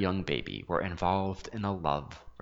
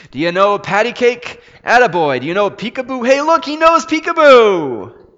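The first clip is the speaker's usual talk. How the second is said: put-on much higher, much louder drunken shouting across the room; shouting old bum; 14 semitones higher, 14 dB louder than usual. A narrator's reading. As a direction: holler like a tipsy old man delighted by a baby. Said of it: "was shouting from across the room".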